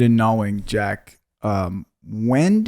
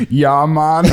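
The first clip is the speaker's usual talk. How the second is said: mimicking a botched British accent; British attempt gone comically astray; failed British accent; 3 semitones higher, 4 dB louder than usual. Friend asked for an accent, badly failed British accent. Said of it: "Do a British accent".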